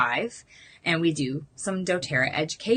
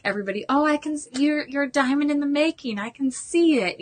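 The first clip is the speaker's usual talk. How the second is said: mock gushing flattery; 8 semitones higher than usual. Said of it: "We're not being like".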